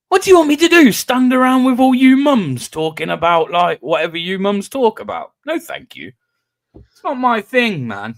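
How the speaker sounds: indignant retort. Retold or imitated. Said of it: "retorting to the missus".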